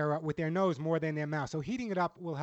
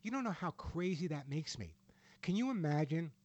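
ordinary talk, nothing marked

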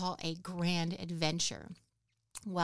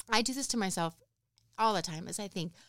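ordinary delivery

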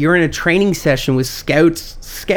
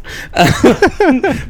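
laughing; 10 semitones higher than usual. Real, not acted. Laughing at punchline.

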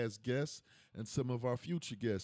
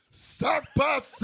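shout; 12 semitones higher, 7 dB louder than usual. Acted. Shouts the catchphrase alone.

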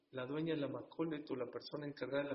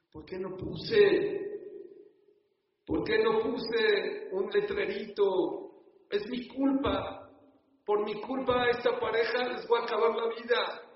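loud, slow, higher guilt-stricken wail; wailing lament; 6 semitones higher, 8 dB louder, slower than usual. Retold or imitated. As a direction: cry out the blame on yourself. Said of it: "Estaba llore y llore".

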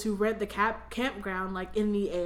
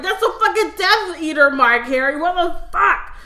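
loud, high outburst with a laugh in it; hammed-up exasperated shouting at a friend; mock alarmed yell; 7 semitones higher, 10 dB louder than usual. Acts out Ron's alarmed reply.

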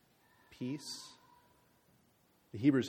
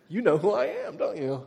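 higher, louder playful fan-bait voice; hamming up a celebrity who expects to be recognised; mock celebrity; 7 semitones higher, 7 dB louder than usual. Man at the airport teasing the people following him, higher and louder.

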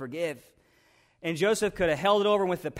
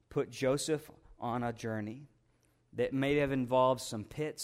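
ordinary delivery

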